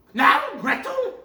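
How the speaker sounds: high loud witch cry; impatient witch calls out to the girl. Acted. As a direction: shriek for Gretel impatiently. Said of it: "she cried to the girl".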